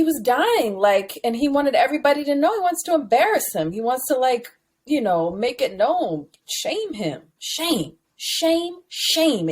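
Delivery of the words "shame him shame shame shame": higher, spaced-out shame chant; mock public shaming. Publicly shaming the cheating best friend, mock chant. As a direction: intone each word as a separate verdict.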